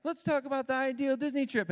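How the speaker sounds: high-pitched mock child voice; son playing mom. Young son acting out mom's line, high mock voice. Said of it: "Cruz is playing the role of my wife".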